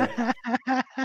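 brief high laugh. Real, not acted. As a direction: laugh along, amused. Riso da piada do colega. (risada aguda)

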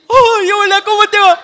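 high, loud, nervous suitor's hello; nervous greeting. Pretend. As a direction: blurt it out high and loud, flustered. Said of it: "le decís". (saludo nervioso, agudo y fuerte)

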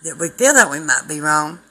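loud, fervent street preacher; a full-voiced revival sermon; preaching at full force. Preaching hard to camera.

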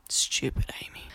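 soft whispered aside; hushed, kicking yourself under your breath; whispered self-scolding. Retold or imitated. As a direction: mutter it to yourself, barely voiced.

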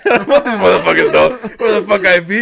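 loud, laughing through the words; laughing loudly. Real, not acted. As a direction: crack up while getting it out. Laughing at his own paranoia.

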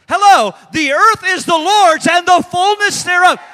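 high, loud preacher's shout; emphatic rebuttal. Real, not acted. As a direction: shout it as an obvious correction. Rebutting the proud, preached loudly.

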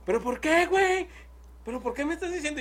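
high, whiny protest, imitating a drunk buddy; mock whiny friend. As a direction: squeak out the friend's indignant question. Mimics friend protesting at party. (imitando a un amigo quejumbroso con voz aguda)

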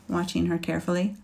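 usual speaking voice

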